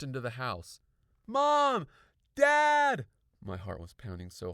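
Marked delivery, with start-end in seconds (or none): 1.3-3.0 high, very loud shouts; urgent calling. A narrator's reading. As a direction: shout through the house for your parents. Calling for parents on bursting in.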